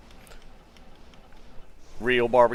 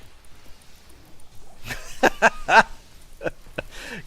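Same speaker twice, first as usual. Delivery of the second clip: real laugh; 10 semitones higher than usual. Real, not acted. Reacting to funny chat message.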